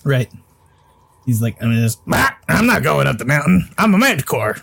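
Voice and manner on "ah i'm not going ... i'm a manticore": lightly voiced manticore growl; a hint of monster voice. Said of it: "That's my manticore voice".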